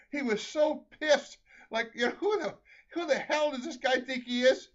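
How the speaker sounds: ordinary talk, nothing marked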